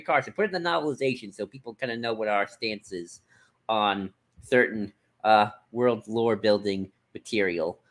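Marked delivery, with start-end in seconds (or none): none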